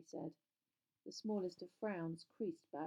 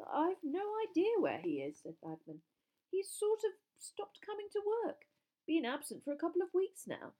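higher, louder evasive bluster; flustered official; 9 semitones higher, 5 dB louder than usual. A narrator's reading. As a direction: play a rattled man making excuses.

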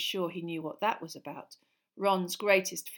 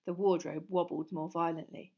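plain reading voice

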